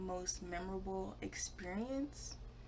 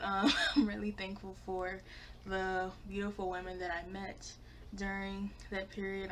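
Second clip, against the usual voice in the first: ordinary talk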